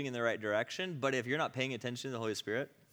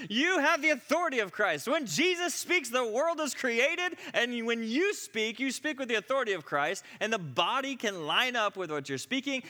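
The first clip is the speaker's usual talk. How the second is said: impassioned preaching; 12 semitones higher, 7 dB louder than usual. Retold or imitated. Teacher telling the room about authority in Christ, loud preaching. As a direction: preach it at full force to the whole room.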